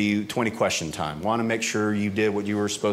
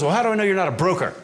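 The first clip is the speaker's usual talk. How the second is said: higher, fast suspicious question; suspicious agent; 8 semitones higher, 3 dB louder, faster than usual. Retold or imitated. Customs agent grills the traveller, higher rapid suspicion. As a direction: catch him out quickly. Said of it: "And he goes".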